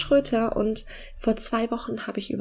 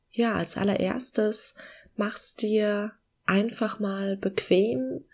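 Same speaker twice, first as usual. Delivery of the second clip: slow, soft meditation voice with long pauses; calm guiding voice, gently slowing down; guided meditation; 5 dB quieter than usual. Acted.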